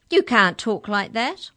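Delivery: put-on loud, indignant protest from a girl; indignant little girl cutting in loudly. A narrator's reading. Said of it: "said Mallory indignantly".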